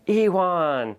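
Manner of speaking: calling out. Retold or imitated. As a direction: call his name across the room, ee-WAHN. Agent calls out to the client.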